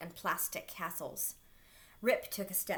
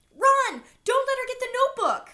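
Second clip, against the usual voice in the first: high, loud and fast warning; urgent shout; 14 dB louder, faster than usual. A narrator's reading. Alexander urging Rip to flee, fast loud shout. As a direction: hurry him out with a yell.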